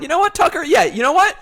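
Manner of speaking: high, loud hyped-up outburst; as hyper as possible. Pretend. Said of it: "just be as hyper as possible".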